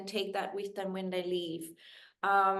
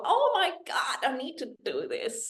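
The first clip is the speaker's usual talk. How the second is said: excited realisation; 4 semitones higher than usual. Retold or imitated. Listener hearing the sleep benefits, excited gasp. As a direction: gasp it out like a sudden revelation.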